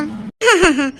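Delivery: a low, amused burst of laughter; hearty laugh. Acted. Mother-in-law laughs at the song playing during cleaning, hearty laugh. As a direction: laugh it off at the singing.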